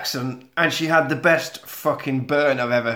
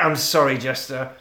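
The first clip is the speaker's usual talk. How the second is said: ordinary talk, nothing marked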